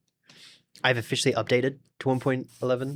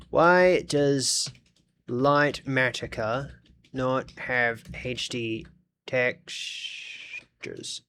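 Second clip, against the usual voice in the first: typing aloud; much slower than usual. Real, not acted. Streamer typing a search for a texture problem, slow and halting. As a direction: say each word slowly as you type it.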